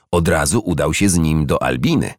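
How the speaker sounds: plain reading voice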